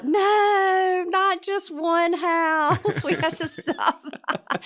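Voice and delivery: put-on high, drawn-out kid wail; mock protesting kids. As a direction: drag out the kids' plea, high-pitched. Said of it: "my kids say that all".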